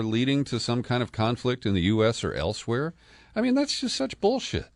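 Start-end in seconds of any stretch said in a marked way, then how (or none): 3.3-4.7 host mocking a historical claim, scoffing in disbelief. Real, not acted. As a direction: pitch jumps way up in exasperated scorn.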